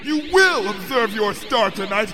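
high, echoing shout building to a roar; seething command. A narrator's reading. Princess orders her friend to enjoy the gift, furious booming command. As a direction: lay down the law, thundering by the end. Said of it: "Luna seethed at me".

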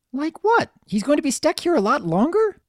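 put-on high, childish questioning; young pony Pinky. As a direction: ask like an uneasy little filly.

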